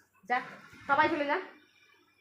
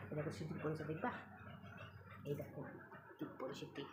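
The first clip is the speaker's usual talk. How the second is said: much softer, lower, breathless whisper; 10 semitones lower, 20 dB quieter than usual. Real, not acted. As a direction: whisper so the cat stays calm. Whispering to viewers. (ফিসফিস করে দেখানো)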